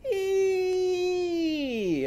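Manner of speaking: very high, sustained squeal of ee; long squeak. Shown as a demonstration. Teacher making Eve's sound squeak, long high squeal. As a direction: squeak it out high, sliding down at the end. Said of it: "it squeak".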